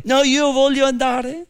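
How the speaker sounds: high eager protest, rising at the end; mock zealous insistence. Ahimaaz refuses Joab's order to stay, mock zealous insistence. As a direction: imitate an overkeen runner who won't be told no. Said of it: "con tutto questo zelo, zelante".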